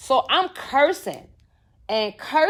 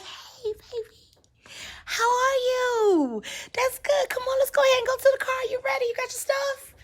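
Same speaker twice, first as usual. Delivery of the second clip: very high, soft singsong greeting; sweet smiling mama; 13 semitones higher, 5 dB quieter than usual. Retold or imitated. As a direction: coo sweetly like a doting mother. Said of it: "she was just smiling, so pretty".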